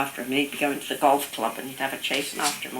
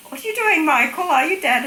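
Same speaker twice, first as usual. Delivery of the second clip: high, loud checking questions; nuns calling; 7 dB louder than usual. Retold or imitated. Nuns to dying grandfather under the sheet, high loud questioning. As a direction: imitate nuns calling to a patient, pitched right up.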